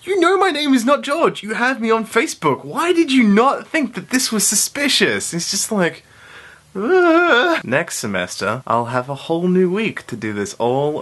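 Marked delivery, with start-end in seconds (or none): 0.0-5.3 much higher, exasperated telling-off; playfully scolding the gullible classmate; mock yell. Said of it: "Then I start yelling at". 6.7-7.6 high, louder ugh of embarrassment; embarrassed groan. Retold or imitated. Classmate's embarrassed reaction.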